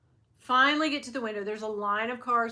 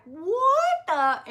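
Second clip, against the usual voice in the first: loud high disbelief; shocked; 9 semitones higher, 8 dB louder than usual. Retold or imitated. Driver reacting to being rear-ended, shocked. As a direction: blurt it in shock. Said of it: "I'm like".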